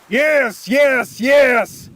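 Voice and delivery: loud, high, building shout. A narrator's reading. Cornelius answers the narrator in a mad shout. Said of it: "Cornelius shouted".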